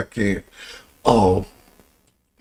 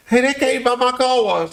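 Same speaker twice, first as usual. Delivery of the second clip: excited retelling; 14 semitones higher than usual. Retold or imitated. Retold telling Kate excitedly.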